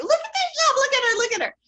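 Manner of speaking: excited squeal. Real, not acted. Host gushes to viewers about the heroine's look. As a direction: squeal with delight over her. Gushing over the film's heroine.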